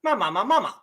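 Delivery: Ma, ma, ma, ma, ma tossed off quickly through the tones; 快速念声调; playful tone run. Shown as a demonstration. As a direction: rattle through the five ma for fun. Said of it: "Just for fun".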